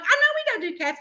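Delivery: high-pitched wheedling reply; retold plea to a reluctant husband; pleading concession.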